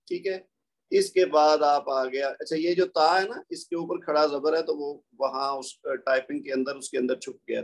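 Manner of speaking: ordinary delivery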